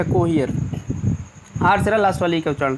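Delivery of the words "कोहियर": "कोहियर" as ko-hee-ar, lower and falling; ee-a ending. Shown as a demonstration. Teacher puts the sounds together, "कोहियर" as ko-hee-ar. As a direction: model "कोहियर" with ee gliding into a. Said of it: "ई से ई आ हो गया".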